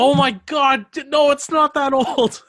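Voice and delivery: shocked. Real, not acted. Indignant at old show claim.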